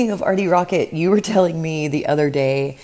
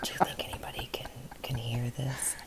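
breathy playful whisper; 5 semitones lower, 16 dB quieter than usual. Pretend. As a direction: whisper it like a secret. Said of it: "you can whisper sweet nothings into that microphone".

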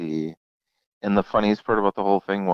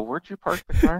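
incredulous brother; 12 semitones higher than usual. Retold or imitated. Brother questioning sister.

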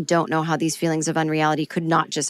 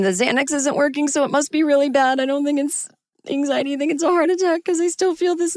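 higher, anxious pleading; panicked insisting; 8 semitones higher than usual. Retold or imitated. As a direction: insist it's a heart attack, scared.

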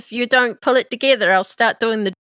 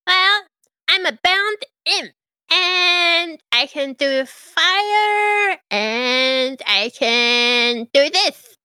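higher, slow proud little imp; 6 semitones higher, much slower than usual. Acted. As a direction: show off the imp's tricks. GM voices imp.

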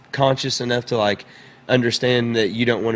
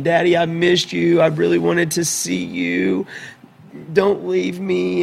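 put-on higher, slow pleading little girl; imitating his crying daughter, higher and slow; mock crying child; 7 semitones higher, slower than usual. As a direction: plead like a tearful small child. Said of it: "she comes running to me crying".

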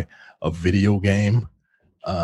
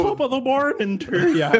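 put-on Irish accent; 14 semitones higher than usual. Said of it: "about to do an Irish accent".

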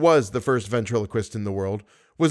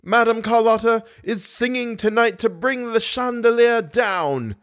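ghost voice; 10 semitones higher than usual. Acted. Eric showing Christine his ventriloquism, high eerie voice. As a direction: throw a high, eerie voice across the room. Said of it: "and it says".

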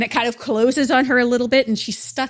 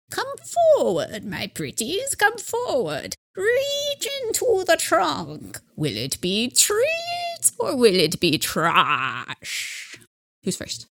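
put-on slow, creepy old witch; 7 semitones higher, 4 dB quieter, much slower than usual. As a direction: beckon them in like a witch. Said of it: "She sounds like a witch".

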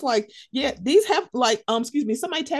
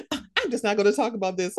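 fast dismissive; 3 semitones lower, much faster than usual. Retold or imitated. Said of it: "And she was like".

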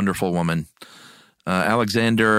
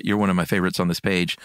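normal talking voice

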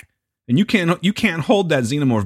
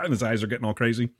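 ordinary talk

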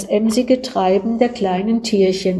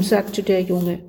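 ordinary delivery, nothing marked

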